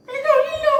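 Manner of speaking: very high, squeaky puppet voice; high-pitched kids' show puppet; Jikkumon. Acted. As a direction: play a tiny child character in a squeal. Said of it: "സുഹൃത്തിൻ്റെ പേര് ജിക്കുമോൻ എന്നാണ്".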